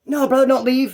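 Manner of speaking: high-pitched retold plea; mock pleading. Friend begging the driver to stay, mock pleading. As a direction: imitate a clingy friend begging.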